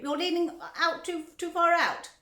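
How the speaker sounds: high, loud warning; shouting. A narrator's reading. Father warning his son he leans too far, shouting. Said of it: "shouted Mister Gloop".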